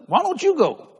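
ordinary talk, nothing marked

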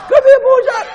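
high, loud call; calling the dog. Retold or imitated. Jogger calling his dog home, high loud call. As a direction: sing out to the dog from down the road.

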